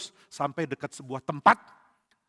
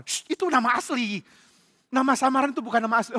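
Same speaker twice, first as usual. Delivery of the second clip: high, urgent correction getting louder; exasperated hushing; 8 semitones higher, 5 dB louder than usual. Acted. Bandit correcting priest who revealed his name, exasperated. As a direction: shush him, then scold in a raised pitch.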